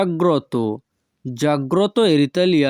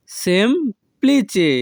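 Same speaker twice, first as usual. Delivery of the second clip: সেমপ্লিচে split slowly after the first syllable; 5 semitones higher, slower than usual. Shown as a demonstration. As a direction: pause inside সেমপ্লিচে, "sem... pli-che". Teacher repeats word split for learners.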